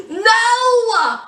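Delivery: high loud burst of disbelief; shocked no. Real, not acted. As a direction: shriek it in shock. Shocked outburst.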